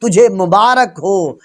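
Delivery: usual speaking voice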